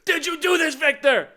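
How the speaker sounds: high, loud dramatic trailer line; mock-dramatic accusing shout; Pedro Pascal line. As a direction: deliver it like the big trailer moment. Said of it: "magnificent line delivery by Pedro Pascal".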